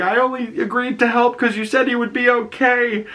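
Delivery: high, squeaky mock protest; mock distress. Shmi protesting as the body is dragged away, mock distress. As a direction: imitate an upset kid in a high voice.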